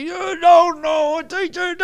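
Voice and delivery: high, drawn-out imitated scream. Coach exploding at his interviewer in the car, screaming. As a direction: scream it like an enraged coach, slowly. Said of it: "he starts screaming at me".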